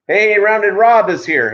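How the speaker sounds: high, loud, drawn-out hello to a viewer; excited greeting. Real, not acted. Streamer greeting Rounded Rob as he arrives, loud and excited.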